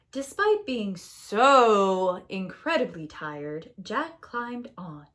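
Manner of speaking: "so incredibly tired" stretched slowly; slow stressed. A narrator's reading. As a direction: drag out the tiredness.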